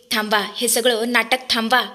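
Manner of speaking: plain reading voice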